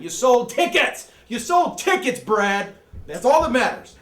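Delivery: high, loud, slow shout; shouting mock praise at Brad; loud cheer. Real, not acted. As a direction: yell it like a fan. Cheering Brad's box office.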